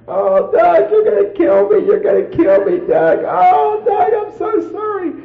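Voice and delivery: high, loud imitated wailing plea; mock bawling. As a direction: play the wife sobbing on her knees, hammed up. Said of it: "bawling and screaming".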